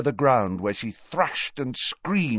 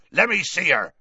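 raised, urgent demand; shouted command; 7 semitones higher, 3 dB louder than usual. A narrator's reading. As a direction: push through with a raised voice. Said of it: "shouted Hagrid".

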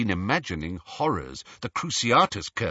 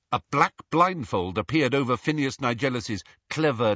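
ordinary delivery

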